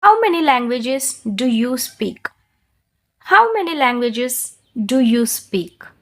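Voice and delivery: very slow, clearly spaced words; slow, careful model for repeating; slow shadowing model. Shown as a demonstration. Teacher models questions slowly to shadow. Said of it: "high and low-గా చెప్తాను".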